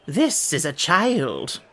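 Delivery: drawn-out, swooping introduction; eager. A narrator's reading. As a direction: present her with slow, eager showmanship. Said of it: "Hare replied eagerly".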